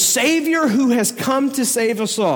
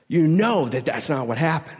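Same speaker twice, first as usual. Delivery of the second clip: normal talking voice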